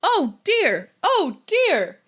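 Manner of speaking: high fussy "oh dear"; fussing like a prim butler robot; flustered robot. Pretend. Robot flustered by leaf blower.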